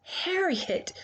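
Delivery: usual speaking voice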